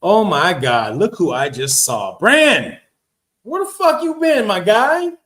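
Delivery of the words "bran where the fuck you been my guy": excited greeting. Real, not acted. Spots an old friend in chat.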